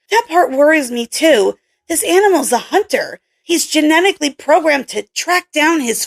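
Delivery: a hint of a brusque young scientist; Kelly's voice. Kelly's voice held.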